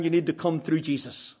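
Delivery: ordinary talk, nothing marked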